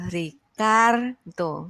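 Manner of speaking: slow "Ricardo" in three separate syllables, CAR held; stress on CAR. Shown as a demonstration. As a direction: break up "Ricardo" as Ri-CAAR-do.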